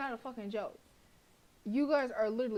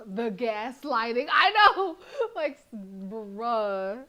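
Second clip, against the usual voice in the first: laughing; 8 dB louder, much slower than usual. Real, not acted. Player laughing at the gaslighting, laughing hard. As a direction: crack up in disbelief. Cracking up at the game.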